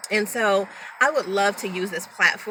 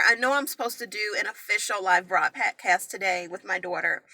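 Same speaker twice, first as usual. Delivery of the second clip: usual speaking voice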